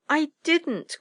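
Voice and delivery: put-on high-pitched childlike denial; indignant high cry; cried protest. A narrator's reading. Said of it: "cried Screecher and Flipperty together".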